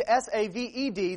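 S A V E D, each letter named separately and firmly; spelled out. Shown as a demonstration. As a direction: spell out saved letter by letter for the congregation. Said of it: "If you are saved".

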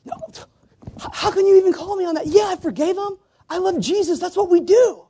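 high, fast mock protest; mock defensive churchgoer. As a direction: squeal it like an offended hypocrite.

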